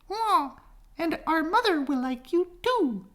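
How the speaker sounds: put-on very high, chirpy baby-bird voice; baby birds promising. Baby birds to the duckling, promising, very high and chirpy. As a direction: promise it like tiny cheerful chicks. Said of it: "they promised".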